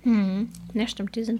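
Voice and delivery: kauend gesprochen; mit vollem Mund. Real, not acted. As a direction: beim Kauen reden, Mund voll Schokolade. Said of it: "[name] kaut". (mit vollem Mund sprechen)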